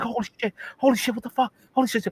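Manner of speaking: high-pitched frantic exclamations; mock shock. Wife reacts to the gyrating, high mock panic. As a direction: squeal it, hammed-up and overwhelmed.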